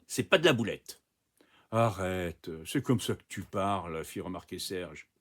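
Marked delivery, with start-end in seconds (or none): none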